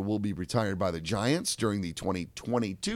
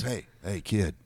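put-on a gentle old ballplayer calming a kid; Kevin Costner. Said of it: "It's like listening to Kevin Costner talk".

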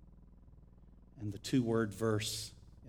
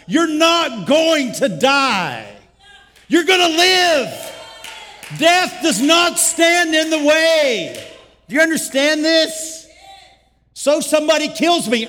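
shouted, much higher and louder preaching; preaching at full force; 12 semitones higher, 18 dB louder than usual. Acted. Pastor preaching to the congregation about not dying, full-force shouting. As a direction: thunder it at the congregation like a revival preacher.

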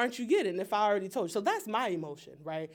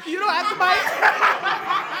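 louder, higher and slow, breaking into a laugh; playful teasing shout; 10 semitones higher, 11 dB louder, slower than usual. Real, not acted. Panelist teasing a heckler, playful laughing shout.

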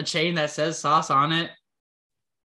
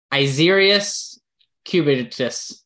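slow guess; 2 semitones lower, much slower than usual. Pretend. Guessing [name]'s real name.

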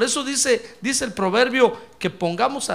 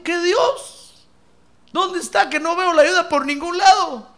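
scoffing man; 8 semitones higher, 6 dB louder than usual. Retold or imitated. Yo le digo.

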